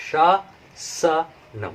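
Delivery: शासनम stretched out very slowly and drawn; very slow demo. Shown as a demonstration. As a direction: hold each sound of शासनम so learners can follow. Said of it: "very slow demo take".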